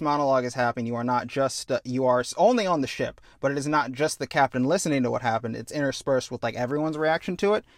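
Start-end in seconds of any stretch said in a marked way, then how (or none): none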